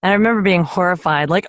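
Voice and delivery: ordinary talk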